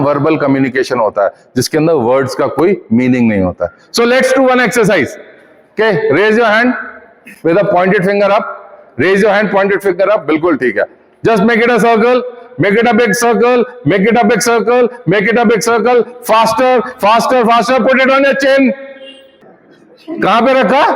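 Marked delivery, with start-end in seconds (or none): 3.9-5.2 energetic call. Acted. Trainer starting group exercise. 5.7-10.2 calling out instructions. Acted. 11.3-20.8 excited trainer geeing up the room; energetic instructions. Acted. Trainer driving group exercise.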